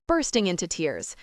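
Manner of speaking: ordinary delivery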